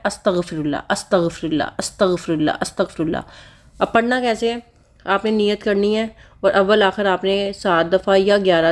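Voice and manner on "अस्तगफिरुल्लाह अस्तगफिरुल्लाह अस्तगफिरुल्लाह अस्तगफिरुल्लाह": soft, falling chant of the one word; short repeated recitation. Shown as a demonstration. Vlogger shows viewers the short form, a soft falling repeated chant. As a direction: murmur it again and again like counting beads. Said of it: "बस इतना पढ़ना है".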